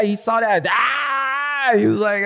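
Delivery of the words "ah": high mock excited yelp; a sudden high yelp of delight; a dad seeing his boy ride, high yelp; excited cry. Styles reacting to the fire.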